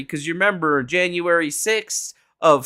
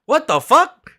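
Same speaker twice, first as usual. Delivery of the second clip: real surprise; 10 semitones higher, 7 dB louder than usual. Real, not acted. Surprised by Twitch bits donation.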